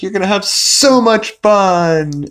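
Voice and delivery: hammed-up chirpy welcome; mock gushing. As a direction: overdo the sugary enthusiasm. News anchors gushing to the interns.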